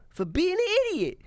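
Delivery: comic retort. Retold or imitated. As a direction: deliver it as a smug punchline. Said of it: "And I go".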